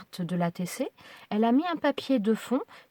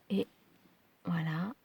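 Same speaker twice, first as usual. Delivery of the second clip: awed whisper; 3 semitones lower, 6 dB quieter than usual. Real, not acted. Awed reveal of the gift.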